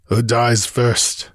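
slow mumble through smashed lips; mumbled. A narrator's reading. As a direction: mumble it slowly, as if the mouth is injured. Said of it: "he mumbled through smashed and bloody lips".

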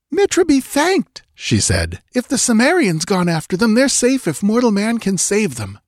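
high, breathless relief; relieved woman. A narrator's reading. Settler woman thanking Mitra in relief, high voice.

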